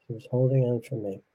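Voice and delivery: quiet repeat. Real, not acted. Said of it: "But she was holding on for me".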